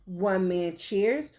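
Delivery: ordinary delivery, nothing marked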